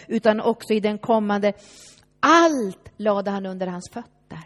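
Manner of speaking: preacher booming out a verse; proclaimed scripture. Acted. Reading Bible words aloud.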